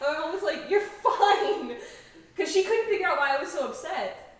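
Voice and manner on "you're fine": laughing mom quote. Retold or imitated. Mom dismissing her crying daughter.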